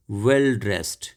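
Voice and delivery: ordinary delivery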